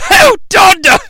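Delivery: leering high shout as Dudley Moore; lecherous shout. Retold or imitated. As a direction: yell it like a dirty old elf. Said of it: "grin on his face like".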